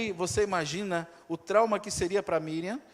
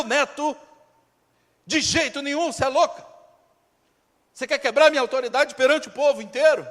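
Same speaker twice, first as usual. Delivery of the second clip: building from stern questions to loud outrage; a king raging at his daughter, getting louder; angry Pharaoh scolding his daughter, rising to a shout; furious king; 3 semitones higher, 7 dB louder than usual. Acted. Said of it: "falando assim".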